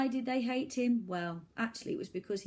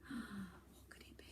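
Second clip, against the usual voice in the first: gasp, then a slow whisper; suspense whisper; 17 dB quieter than usual. A narrator's reading. Narrator whispers to hold the listener in suspense.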